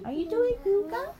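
put-on tiny, very high toddler voice; high toddler questioning with babyish "yuga"; two-year-old's voice. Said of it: "she's gonna say pretty soon".

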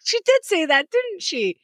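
incredulous. Retold or imitated. Retelling her reply to Xerxes.

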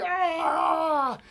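high, loud mock battle yell; a hammed-up war cry as the sword comes out. Peter pulls out his sword.

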